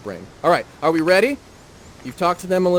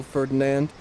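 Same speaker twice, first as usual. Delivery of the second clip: ordinary delivery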